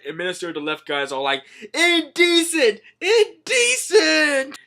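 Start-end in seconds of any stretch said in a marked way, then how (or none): 1.7-4.6 high, loud, long-drawn exclamation; mock outraged cry. Ministers protest at the skeleton, mock outraged cry. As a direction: stretch it into a scandalised wail.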